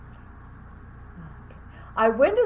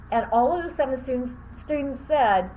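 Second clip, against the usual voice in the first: usual speaking voice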